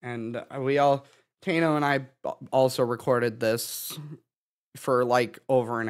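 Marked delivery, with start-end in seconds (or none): none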